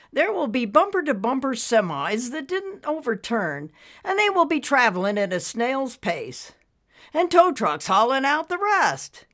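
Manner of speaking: husband explaining the blocked highway; resigned husband. Acted. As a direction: talk her out of hope gently.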